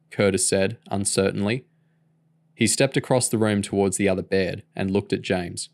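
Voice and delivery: plain reading voice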